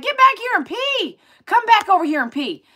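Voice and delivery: high, loud call to the dog; urging the dog to come back and pee, loud high call. Retold or imitated. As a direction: holler it after a runaway dog.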